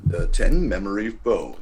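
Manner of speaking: low, vibrating voiceover imitation; deep announcer impression. Having a go at that guy's voice, low announcer impression. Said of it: "It's a very low".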